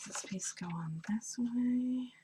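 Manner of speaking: soft, absent-minded self-talk; under the breath, thinking aloud; mumbling to oneself. Real, not acted. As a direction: mumble it quietly while puzzling it out. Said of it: "as I mumble to myself".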